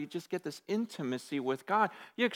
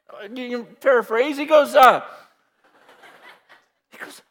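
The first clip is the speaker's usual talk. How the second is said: ordinary talk, nothing marked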